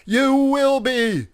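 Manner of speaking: high, loud excited cheer; enthusiastic shout. Acted. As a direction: cheer him on at full voice. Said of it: "shouted an enthusiastic Blue Jay".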